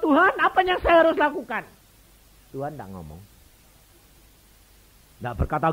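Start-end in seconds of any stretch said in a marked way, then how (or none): 0.0-1.6 loud, high cry of prayer; desperate prayer. Retold or imitated. Praying in the delivery room, loud desperate cry. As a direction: call out to God in need.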